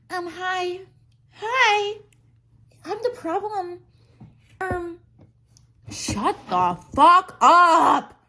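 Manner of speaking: high, far-off mock of the singer; hammed-up parody of a pop star. Mocking the singer's lyric, high and ridiculous. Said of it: "then she goes like".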